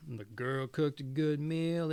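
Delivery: plain reading voice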